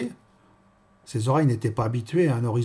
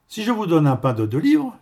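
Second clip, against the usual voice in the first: loud, higher-pitched shopkeeper; brassy baker woman; 5 semitones higher, 6 dB louder than usual. Acted. Baker stating her terms to a customer, loud and high. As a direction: lay down terms loudly and high.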